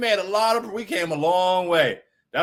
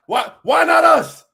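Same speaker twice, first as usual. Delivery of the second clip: hyped up; 8 semitones higher, 8 dB louder, slower than usual. Real, not acted. Rhetorical hype to chat.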